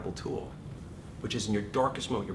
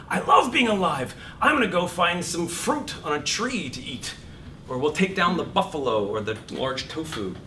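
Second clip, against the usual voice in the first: joy voice; 6 semitones higher, 5 dB louder than usual. Acted. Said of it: "the joy voice is what makes you go".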